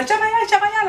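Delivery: very high-pitched put-on squawk; mock crowd outcry. Crowd telling fly-covered woman to move, mock outcry. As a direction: shoo her away in a high crowd voice.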